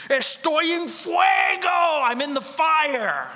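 high, loud, slow anguished cry; retold scream. Preacher re-enacting the patient's screams, loud slow wail. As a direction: howl it like someone in flames. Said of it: "a voice scream".

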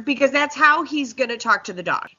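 ordinary talk

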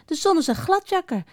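high-pitched grumbling insult; mopperend. A narrator's reading. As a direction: grumble about that slippery sun. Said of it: "Zo mopperden ze nog een tijdje door". (hoog, mopperend gescheld)

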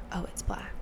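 imitated whispered remark; very quiet. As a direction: whisper it like the barely audible nail technician. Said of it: "the woman was so quiet".

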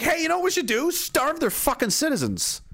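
high, mock-bright scheming voice; hammed-up eager bad idea, pitched high; mocking NATO. NATO pitching a blockade of Kaliningrad, mock-eager and high. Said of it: "nato was like".

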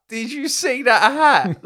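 high, loud, drawn-out mock hysterics; retold hysterics. Friend retelling giggle fit over a hat, mock hysterics. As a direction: squeal it slowly as if in fits. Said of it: "laughing just hysterically, like, in tears".

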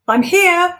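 high, loud flustered call; Ivor calling out. Acted. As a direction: call out in a panic. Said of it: "he called, rushing to the door".

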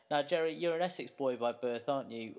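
ordinary delivery

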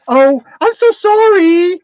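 high, slow, sappy mock sorry; mocking apology. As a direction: drip fake sweetness on it, slowly. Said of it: "would you see ... going".

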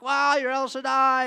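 loud, high awed praise; awed wow. Retold or imitated. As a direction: marvel out loud. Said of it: "Everyone's like".

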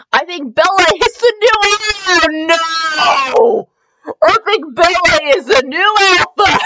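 high, drawn-out wail through laughter; laughing disbelief. Real, not acted. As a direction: squeal the line while cracking up. Reader cracks up at the line.

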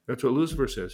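usual speaking voice

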